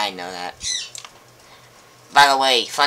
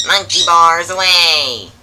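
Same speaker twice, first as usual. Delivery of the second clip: excited; 3 semitones higher, 9 dB louder, slower than usual. Acted. Leaping onto the monkey bars.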